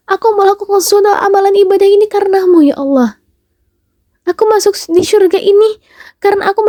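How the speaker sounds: high, pleading servant, begging not to be dragged off; panicked pleading cry. Acted. (hamba memohon dengan panik dan nada tinggi kepada Allah)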